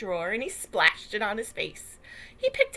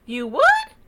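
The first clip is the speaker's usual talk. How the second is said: loud, surprised question; can't believe the offer; disbelieving; 7 semitones higher, 11 dB louder than usual. Acted. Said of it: "could hardly believe his eyes".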